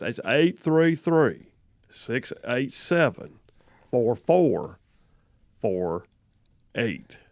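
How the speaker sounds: slow, deliberate number read, each digit spaced. Shown as a demonstration. Host slowing the phone number for listeners who complain, slow. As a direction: spell the number out slowly for the listeners. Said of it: "gotta slow it down".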